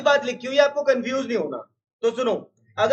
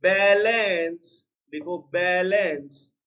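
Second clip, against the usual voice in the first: slow dictation; 4 semitones lower, much slower than usual. Shown as a demonstration. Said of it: "ये balance थोड़ी ना".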